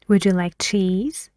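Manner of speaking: "cheese" lifting up at the end; rising tune on cheese. Shown as a demonstration. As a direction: let the voice climb on "cheese". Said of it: "your voice goes higher".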